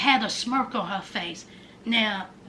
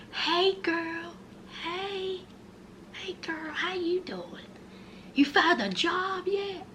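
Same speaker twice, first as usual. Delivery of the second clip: very soft, teasing hello; mock-sweet greeting; 4 semitones higher, 11 dB quieter than usual. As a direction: coo it quietly, poking fun at her. Teasing greeting to absent Brittany.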